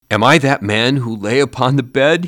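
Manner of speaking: terrified Scrooge crying out. A narrator's reading. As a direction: cry out in dread on his knees. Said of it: "he cried".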